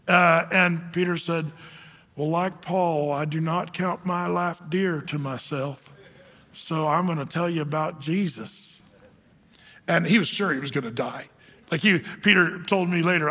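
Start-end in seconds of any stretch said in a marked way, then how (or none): 2.2-8.8 a hint of a Southern drawl, unhurried; lightly drawn-out Southern accent, calm; slow southern drawl. Peter answering the man threatening his neck, light slow Southern drawl. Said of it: "he talked really slow like this with a southern drawl".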